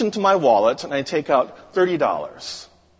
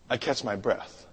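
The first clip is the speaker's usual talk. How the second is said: usual speaking voice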